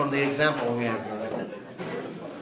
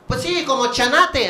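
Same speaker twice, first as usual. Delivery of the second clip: a higher, lightly voiced Mexican mom; mock mom; 9 semitones higher than usual. Mom answering her son about his friend, in a lightly voiced high pitch. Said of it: "I'm like".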